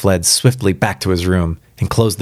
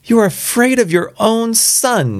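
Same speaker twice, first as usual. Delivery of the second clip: high-pitched accusing outcry; struggling cry. A narrator's reading. Wife struggling against her frightened husband, high accusing cry. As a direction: throw it at him high and agitated. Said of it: "she cried, struggling".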